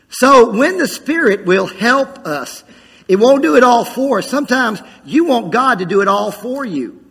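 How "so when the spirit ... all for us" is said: ordinary talk, nothing marked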